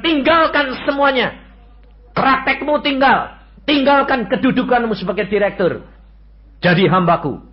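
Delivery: God's command booming from the pulpit. Retold or imitated. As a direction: proclaim it like a divine order. God's command retold in sermon.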